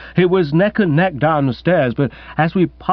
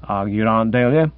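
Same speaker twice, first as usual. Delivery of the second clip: ordinary delivery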